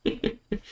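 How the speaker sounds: laughing so hard it squeaks; high laugh. Real, not acted. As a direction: burst of high-pitched laughter. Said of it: "I'm crying".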